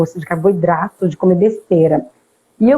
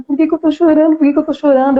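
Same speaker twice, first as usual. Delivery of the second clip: mock tearful wail, higher-pitched; imitando choro; 7 semitones higher, faster than usual. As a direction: replay a weepy, bewildered question in a raised voice. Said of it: "e eu chorava". (imitando um choro, com voz mais aguda)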